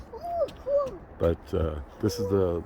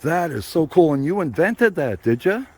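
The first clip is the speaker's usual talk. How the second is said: impressed grandma; 8 semitones higher, 5 dB louder than usual. Real, not acted. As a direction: gush over her invention up high. Grandma praises granddaughter's invention.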